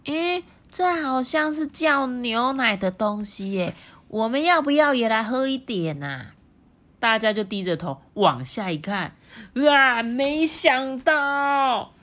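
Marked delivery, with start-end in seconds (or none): 0.0-6.3 curious frog. Acted. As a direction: tempt the others to taste. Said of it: "第三只青蛙就说".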